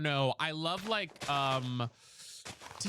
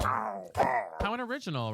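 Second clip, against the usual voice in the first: drawn-out sarcasm; 4 semitones lower, slower than usual. Real, not acted. Repeats mock, drawn out.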